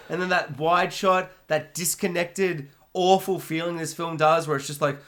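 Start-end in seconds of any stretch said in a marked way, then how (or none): none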